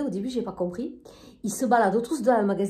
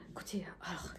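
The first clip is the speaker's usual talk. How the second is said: hushed aside; whisper; 5 semitones lower, 21 dB quieter, faster than usual. Real, not acted. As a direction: whisper it to the audience. (chuchoté)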